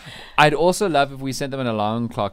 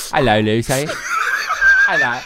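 loud mock British hello with a laugh; laughing imitation of English greeting; 6 dB louder than usual. Host demonstrating Lucy's British greeting.

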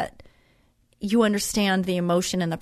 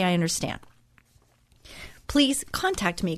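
normal talking voice

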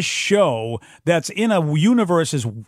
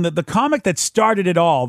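ordinary delivery

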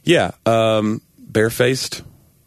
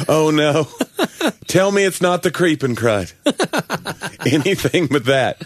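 higher hammed-up dismay, breaking into laughter; laughing mock horror; 6 semitones higher than usual. Mother hears the crud diagnosis, mock dread through laughter. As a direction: play horrified but keep cracking up.

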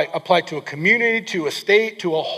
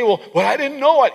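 higher, louder whiny mock excuse; 6 semitones higher, 4 dB louder than usual. Preacher mimicking a churchgoer's excuse, higher whiny mock plea. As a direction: play the one who pleads ignorance. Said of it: "You can't say".

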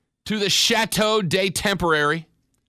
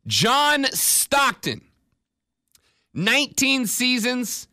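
John Stockton drawn out slowly and higher; slow announcer build; 5 semitones higher, much slower than usual. Acted. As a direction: stretch the name like an introduction. Drawn-out name in list.

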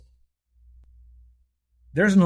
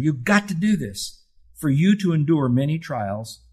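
ordinary talk, nothing marked

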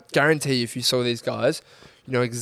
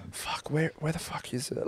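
mock mutter, much softer than usual talk; mumbling under breath; 3 semitones higher, 11 dB quieter than usual. Pretending to hunt for gym gear, mumbled under his breath. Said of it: "mumble under my breath".

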